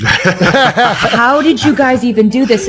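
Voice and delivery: high, slow, laughing wonder; amazed disbelief. Retold or imitated. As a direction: marvel at it, laughing. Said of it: "and I'd just be like".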